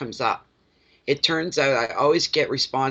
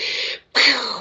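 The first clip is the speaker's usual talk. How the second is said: high whooshing firework noise; imitated firework burst; 10 semitones higher than usual. Host describing a surprising reading, imitated firework burst. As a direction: mimic a firework going off. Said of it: "fireworks everywhere".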